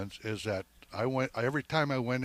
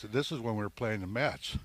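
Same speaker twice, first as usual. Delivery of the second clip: usual speaking voice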